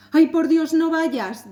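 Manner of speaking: high, loud pleading cry; frightened plea. Acted. The wife begs her husband not to go out, frightened. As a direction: beg him not to go, scared.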